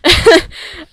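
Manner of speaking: high, loud laugh; bright laugh burst; burst of laughter. Real, not acted. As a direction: laugh out at her own joke. Laughing after her joke.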